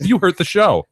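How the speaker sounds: loud, high mock scolding; mock outrage.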